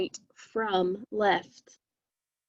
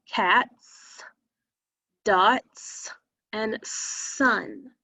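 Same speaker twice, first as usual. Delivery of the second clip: slow demo; much slower than usual. Shown as a demonstration. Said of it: "say those words slowly".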